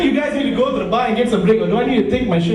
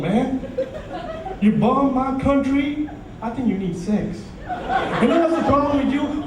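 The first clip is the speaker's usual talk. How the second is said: Bill Clinton impression; 6 semitones higher than usual. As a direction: play it easygoing, then crank it up. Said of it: "he'd probably go up to Osama and say".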